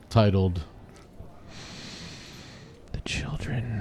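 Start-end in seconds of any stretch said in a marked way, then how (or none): 2.9-3.8 whispered title reveal; dramatic whisper. Acted. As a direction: breathe the title like a secret. Announcing the episode title.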